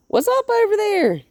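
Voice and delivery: high, loud excited call; excited dog talk. Real, not acted. Trainer calling to a dog, high and excited. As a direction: pitch it way up and loud, drawn to the dog.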